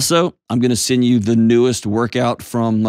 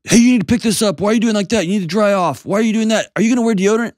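much higher, whiny nagging dad voice; mock nagging parent; 11 semitones higher, faster than usual. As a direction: parody a parent picking at his kid nonstop. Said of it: "Everything is not like a".

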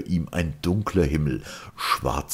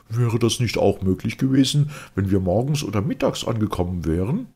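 raised, eager voice of the curious earthman; Fridolin asking; 7 semitones higher, 4 dB louder than usual. A narrator's reading. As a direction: Fridolin presses with a question, voice lifted, quicker at the end. Fridolin asks Sirian curiously. (neugierig fragender Fridolin, höhere Stimme)

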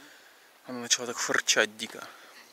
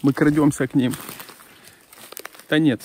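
normal talking voice

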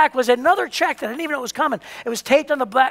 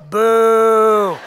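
long, loud, sustained boo; imitated crowd boo; 5 dB louder than usual. Crowd jealous of repeat winner, loud imitated booing. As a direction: hold it out like a jeering crowd. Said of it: "everybody going".

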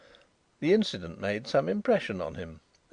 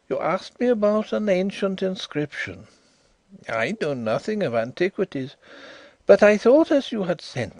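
Indian visitor voice; 7 semitones higher, 4 dB louder than usual. A narrator's reading. Drishna voiced in story.

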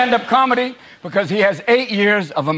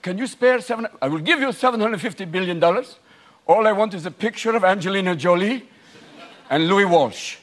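mock Saudi. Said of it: "The Saudi".

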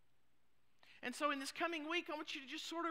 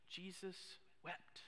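slow, hushed verse with a pause; solemn quotation; 6 semitones lower, 11 dB quieter, slower than usual. Acted. Preacher quotes the very famous verse, slow and hushed. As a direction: quote it softly and gravely, letting each word sit.

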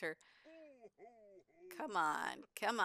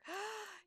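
excited gasp; 13 semitones higher, 5 dB quieter than usual. Real, not acted. Father Winter arrives.

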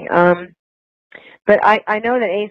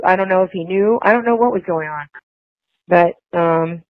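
ordinary talk, nothing marked

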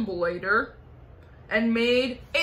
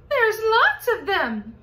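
high, loud, slow little bug cry; ladybug voice; 9 semitones higher, 6 dB louder, slower than usual. Acted. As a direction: squeal it out like a tiny ladybug. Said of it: "said the ladybug".

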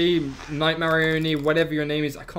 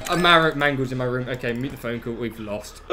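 ordinary delivery

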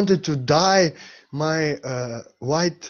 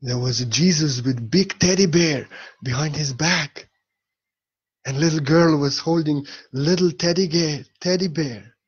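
ordinary delivery

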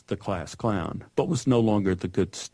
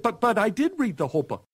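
put-on high, fast little boy's protest; pleading child; 11 semitones higher, faster than usual. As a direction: stammer it out like a wronged kid.

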